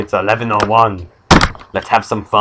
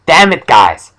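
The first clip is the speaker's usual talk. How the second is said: loud, high mock-angry protest; exasperated outburst; 6 semitones higher, 8 dB louder, slower than usual. Blind noseless man fed up with bullies, exasperated outburst. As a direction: burst out in comic frustration.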